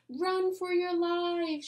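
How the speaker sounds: high, loud, slow alarmed shout. A narrator's reading. Neil warning the class to flee, high slow shout. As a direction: wail it out as a hammed-up alarm. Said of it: "shouted Neil".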